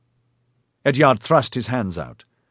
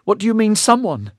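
higher, louder, insistent; demanding; 13 semitones higher, 5 dB louder than usual. Acted. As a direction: press him hard for an answer. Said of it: "Fahin demanded".

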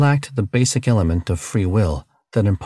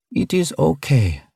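high, slow, gentle alien voice; reassuring; 8 semitones higher than usual. Acted. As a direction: soothe him gently. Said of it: "laying a reassuring hand".

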